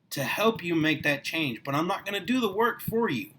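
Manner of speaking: ordinary delivery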